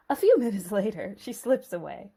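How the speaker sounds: normal talking voice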